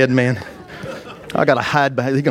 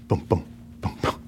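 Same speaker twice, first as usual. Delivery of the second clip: heartbeat thump; 8 semitones lower, 10 dB quieter than usual. Retold or imitated. Imitating his pounding heartbeat.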